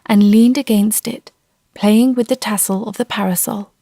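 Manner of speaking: usual speaking voice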